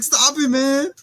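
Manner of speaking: high, slow mock crying; high, slow mock crying with a laugh; whiny mock cry. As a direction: wail it like a scared stoner. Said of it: "does a slow guitar creep".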